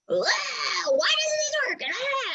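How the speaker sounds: high, drawn-out exasperated wail; hammed-up frustration with slow, wailing vowels; mock frustrated whining. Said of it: "like, just frustrated".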